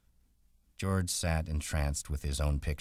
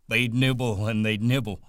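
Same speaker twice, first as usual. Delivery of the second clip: put-on higher, louder, childlike glee; delighted Lenny; 7 semitones higher, 7 dB louder than usual. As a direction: relish the nibbling.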